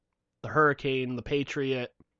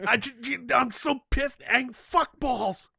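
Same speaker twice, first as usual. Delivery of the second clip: very high, stuttering mock rage; hammed-up pissed-off man; 11 semitones higher, slower than usual. Acting out the furious misspelling emailer, mock enraged. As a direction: splutter it out in exaggerated fury. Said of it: "he must be pissed if he's fucking".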